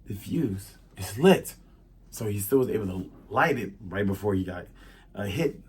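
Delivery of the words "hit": usual speaking voice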